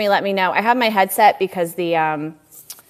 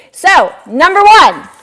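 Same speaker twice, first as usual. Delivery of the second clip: excited announcement; 9 semitones higher, 6 dB louder than usual. Real, not acted. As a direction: announce the winner like a host. Announcing the top buyer.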